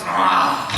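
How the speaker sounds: breathy gasp, then an odd laugh; jinn laugh. Acted. Jinn arriving in the patient, gasp and sneering laugh.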